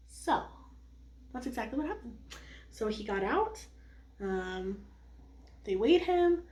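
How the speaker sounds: normal talking voice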